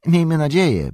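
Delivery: high, loud, emphatic repeat; fervent innkeeper echo. A narrator's reading. Innkeeper repeats Frodo's hope, fervently. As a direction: echo it anxiously, pitch up. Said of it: "powtórzył Butterbur".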